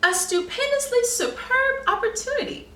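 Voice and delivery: high, grand and chuckling; a wise sheep relishing his words; lofty wise sheep. A narrator's reading. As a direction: savour each big word like a pompous sage. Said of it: "observed wise Woolworth".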